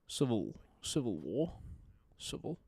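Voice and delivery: drawn-out attempt at a New Zealand accent; New Zealand accent try. Streamer failing to copy the New Zealand cast, halting New Zealand accent. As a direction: stretch the vowels like a Kiwi caster. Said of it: "ah, I can't do it".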